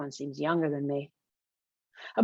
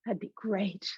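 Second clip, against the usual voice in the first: soft, wistful, higher voice; hollow with longing; 4 semitones higher, 5 dB quieter than usual. Acted. Ava answering Emmett's vague invitation, hollow with longing. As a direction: say it softly, aching to be included. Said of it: "I say, words hollow with longing".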